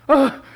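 a loud, strained groan of nerves; frustrated groan. Retold or imitated. Sports dad at his girl's game, loud nervous groan. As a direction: let out a pained loud ugh. Tense parent at softball game.

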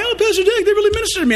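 high, gushing praise; gushing churchgoer. Retold or imitated. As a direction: gush like a flattering churchgoer. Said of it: "People walk by".

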